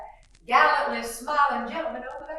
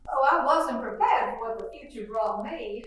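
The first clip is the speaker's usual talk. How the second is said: normal talking voice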